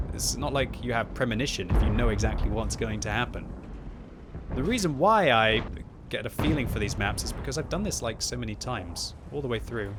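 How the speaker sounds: ordinary talk, nothing marked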